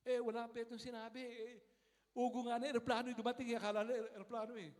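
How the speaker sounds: opgewonden en luid, hoger: 'ugo nga na, eroplano yung dumating'; excited. Retold or imitated. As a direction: roep het vol verbazing uit. Excited retelling of airplane roar.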